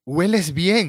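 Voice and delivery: retelling a gushing Lois line; mild mock Lois. A hint of a swooning Lois compliment. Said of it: "Lois le decía".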